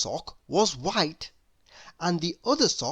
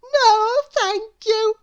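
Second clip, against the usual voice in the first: put-on very high, slow squeaky little girl voice; a tiny cheerful girl, drawn out high; Little Miss Contrary; 9 dB louder, slower than usual. A narrator's reading. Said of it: "smiled little Miss Contrary".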